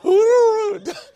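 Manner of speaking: high, loud trilling swan hoot; imitated swan call. Shown as a demonstration. Preacher trying a swan's call for congregation, high hooting. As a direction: attempt a beautiful swan noise. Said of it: "it's kinda like that".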